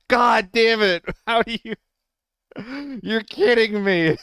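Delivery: high, loud exasperated cursing; loud disbelief at the game; frustrated shout. Real, not acted. Frustrated after being killed.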